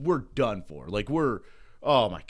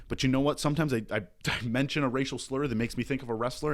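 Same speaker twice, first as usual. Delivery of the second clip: normal talking voice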